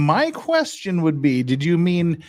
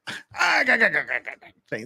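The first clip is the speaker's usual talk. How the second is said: Popeye impression; 3 semitones higher than usual. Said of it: "as Popeye would say".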